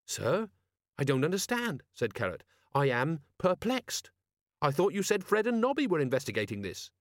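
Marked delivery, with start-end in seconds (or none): 0.1-1.8 pitched up, eager and puzzled; earnest young Carrot. A narrator's reading. Carrot questions his captain, lightly voiced higher and puzzled. 2.7-6.9 pitched up, eager and puzzled; earnest young Carrot. A narrator's reading. Carrot questions his captain, lightly voiced higher and puzzled.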